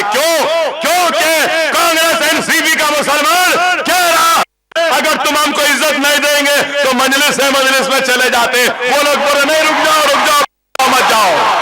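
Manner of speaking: loud, high-pitched rally delivery; impassioned acted exchange. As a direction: act out the pleading at full volume.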